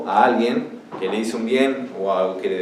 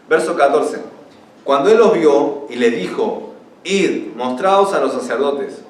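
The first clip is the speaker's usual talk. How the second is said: raised, emphatic Bible reading; scripture reading; 3 semitones higher, 5 dB louder than usual. Acted. Preacher reading Jesus' command to the lepers, emphatic proclamation. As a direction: proclaim the verse to the room. Reads the scripture passage aloud.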